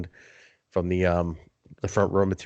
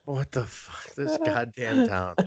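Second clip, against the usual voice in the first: high, slow, fed-up groan of a line; exasperated; 12 semitones higher, 4 dB quieter, slower than usual. Real, not acted. Griping at the confusing city parking signs, slow and high. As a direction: drag it out in disgust.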